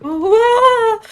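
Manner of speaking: startled scream of fright; real scream. Real, not acted. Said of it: "why did I scream like that".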